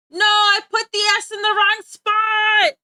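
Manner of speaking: loud, slow exasperated yell; real frustrated shout. Real, not acted. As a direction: yell at your own mistake. Frustrated misspelling name.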